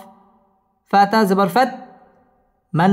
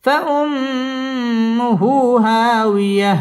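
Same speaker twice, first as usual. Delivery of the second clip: higher, very drawn-out فأمه; high recitation; 4 semitones higher, much slower than usual. Shown as a demonstration. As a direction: stretch فأمه high then drop. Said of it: "wow ঠোঁট গোল করে উচ্চারণ করতে হবে". (উঁচু সুরে টেনে তিলাওয়াত)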